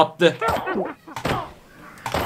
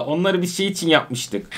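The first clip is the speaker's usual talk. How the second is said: ordinary talk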